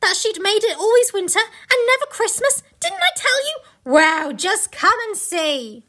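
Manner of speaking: high, rising exultation, slowing at the end; acted gleeful triumph; triumphant Mister Beaver. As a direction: crow it, then draw out the invitation. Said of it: "answered Mister Beaver".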